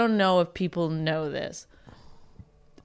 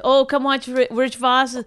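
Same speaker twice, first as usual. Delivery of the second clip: put-on Iraqi accent; 6 semitones higher, 4 dB louder than usual. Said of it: "but in their Iraqi accent".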